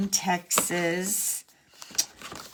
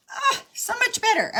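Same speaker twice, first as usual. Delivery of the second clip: relieved delight. Real, not acted. Relieved at fixed card.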